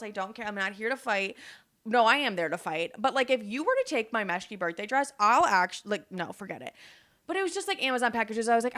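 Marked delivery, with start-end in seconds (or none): none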